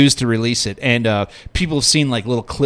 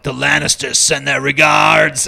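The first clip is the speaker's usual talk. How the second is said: slow, hammed-up menacing delivery; cheesy villain line; slower than usual. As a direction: drag out the villain's cheesy threat. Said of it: "The guy's like".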